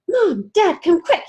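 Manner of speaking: high, loud call for help; urgent yell. Acted. Beanie calling Mom and Dad to her room, urgent. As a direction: call the parents in alarm. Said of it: "yelled Beanie".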